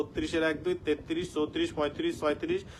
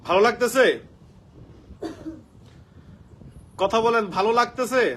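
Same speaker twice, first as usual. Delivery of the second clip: raised, louder call to the class; loud check-in; 4 semitones higher, 5 dB louder than usual. Real, not acted. Teacher calling out to online students, loud and eager. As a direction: call out to wake the room up.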